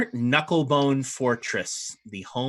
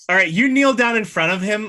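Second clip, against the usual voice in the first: normal talking voice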